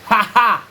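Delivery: short, deliberate "ha ha"; dry mock laugh. Shown as a demonstration. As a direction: let out a staged two-beat laugh. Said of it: "I'm here to laugh".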